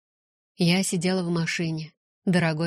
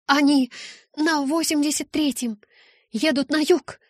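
urgent report; 8 semitones higher, 4 dB louder than usual. Acted. Rose calls out vision of road.

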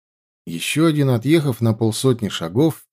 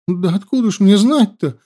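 high, loud whining exclamation; tearful cry; 9 semitones higher, 3 dB louder than usual. A narrator's reading. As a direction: cry it out plaintively. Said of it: "плачущим голосом воскликнула". (плачущим голосом)

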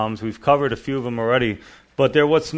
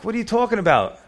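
baffled servants; 8 semitones higher, faster than usual. Retold or imitated. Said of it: "And they're like".

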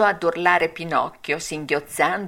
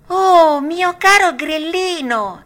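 higher, louder courteous hello; polite greeting; 11 semitones higher, 7 dB louder, slower than usual. A narrator's reading. Pinocchio politely greeting the talking cricket. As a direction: greet an old friend politely. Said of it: "salutandolo garbatamente".

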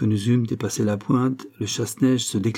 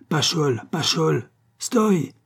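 shouted order; 6 semitones higher, much slower than usual. A narrator's reading. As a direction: call the coachman to halt, climbing to a cry. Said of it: "cria le gouverneur". (crié au cocher, de plus en plus haut)

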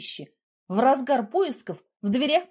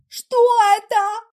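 high startled cry; trembling fright; 9 semitones higher, 8 dB louder than usual. A narrator's reading. Heroine shaken by thunder, frightened. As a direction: jump in fear at the sound. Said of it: "затряслась я".

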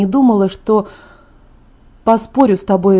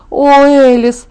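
raised, louder groan of protest; exasperated moan; 4 semitones higher, 5 dB louder, much slower than usual. A narrator's reading. Cornered by her friend's wedding plea, moaning. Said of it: "простонала я".

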